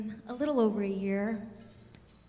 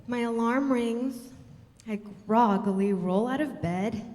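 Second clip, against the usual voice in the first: ordinary talk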